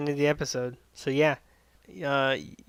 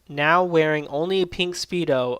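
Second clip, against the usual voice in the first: ordinary talk, nothing marked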